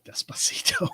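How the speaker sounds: breathy whispered title. Real, not acted. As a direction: whisper the word like a secret. Whispered song suggestion from chat.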